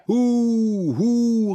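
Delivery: long, high, loud booing; jeering hoots. A narrator's reading. Accused traitors drown out the speaker, hooting loudly. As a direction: hoot him down. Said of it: "riefen die Vaterlandsverräter".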